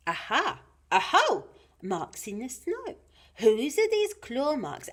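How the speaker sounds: excited little explorer; Gruffalo's child. Acted. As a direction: pipe up at a discovery, pitch leaping. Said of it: "the Gruffalo's child".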